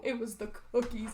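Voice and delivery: hammed-up tearful panic with gasps; fake-cry it, gasping and half laughing; played-up sobbing freak-out; mock freaking out. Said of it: "freaking out, you know?".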